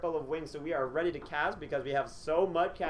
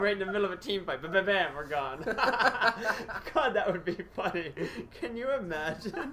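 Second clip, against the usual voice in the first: normal talking voice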